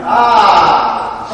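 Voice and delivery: loud laughing cry; startled genie cry. Shown as a demonstration. Drama teacher demoing the genie's loud cry. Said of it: "and then you say".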